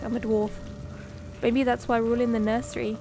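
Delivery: usual speaking voice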